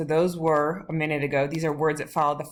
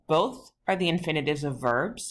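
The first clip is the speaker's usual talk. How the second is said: ordinary talk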